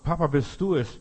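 high, loud cry up to papa; imitated boy's shout. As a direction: call out as the trapped son. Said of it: "dann schreit der Junge noch".

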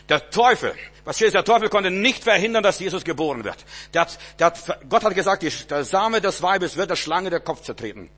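loud, high preacher's proclamation; fervent sermon shout; full-force preaching. Acted. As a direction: drive it home to the congregation.

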